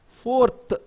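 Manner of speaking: "fourth" drawn out, final TH released hard; stressed final TH. Shown as a demonstration. Teacher shows students word-final TH, "fourth" with stressed ending. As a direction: punch the TH at the end of "fourth". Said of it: "the stress is there on the T H".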